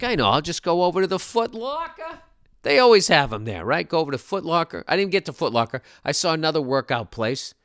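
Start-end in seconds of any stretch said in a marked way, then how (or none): 0.0-2.2 higher, eager self-quote; breezy plan, rising high at the end; acted naive confidence. Himself setting off, acted cheerily.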